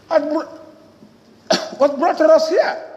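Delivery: ordinary talk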